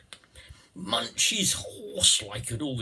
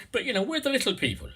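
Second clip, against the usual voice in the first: quick, high Irish lilt; chirpy Irish little person; Irish accent; 12 semitones higher, faster than usual. Pretend. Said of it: "forgive the Irish".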